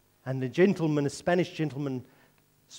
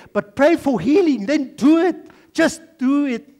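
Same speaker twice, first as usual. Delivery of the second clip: high, insistent preaching, growing louder; 6 semitones higher, 6 dB louder than usual. Real, not acted. Preacher urges congregation to pray for healing, preaching at full force. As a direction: press them urgently to act.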